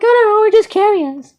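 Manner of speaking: very high put-on voice; high-pitched mock innocence; nosy questioners. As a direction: imitate them in a squeaky, innocent tone.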